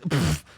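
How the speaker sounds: a scornful puff of air; dismissive pfft. Pretend. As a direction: blow off the idea with a pfft. Scoffing at the IRS.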